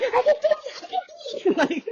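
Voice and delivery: very high, frantic mock squeal; squealed retold protest, edged with laughter; pained shriek. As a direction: re-enact panicked pain at normal volume. Retold cry during painful stitching.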